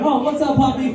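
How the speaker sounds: high, sing-song hello to a dog; friendly puppy greeting. Retold or imitated. Greeting a stranger's dog under a lifted truck, high and friendly. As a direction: coo at the puppy like it's a friend.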